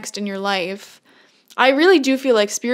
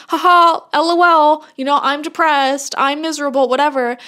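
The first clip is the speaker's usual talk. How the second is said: high, loud mock-flippant voice, pitched up; mocking mimicry; 5 semitones higher, 5 dB louder than usual. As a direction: parody someone laughing off their own misery. Mocking a viewer's flippant excuses.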